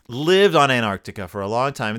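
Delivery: ordinary talk, nothing marked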